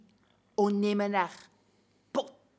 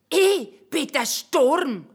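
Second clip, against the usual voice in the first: proud warrior boast; 5 semitones higher, 8 dB louder than usual. Acted. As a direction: announce yourself like a warrior. Samurai proclaims himself the storm.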